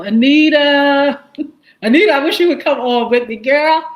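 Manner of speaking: high, loud, laughing call-out to a friend; excited greeting. Real, not acted. Livestream host calling out to viewer Anita, loud and excited. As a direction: greet her like you're thrilled to see her.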